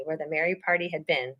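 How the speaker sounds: plain reading voice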